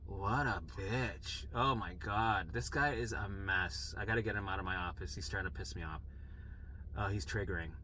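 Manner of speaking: quietly fed up, as if thinking aloud; inner thoughts. Retold or imitated. Nurse privately judging a crying patient, quiet disdain. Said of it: "what the other person's thinking".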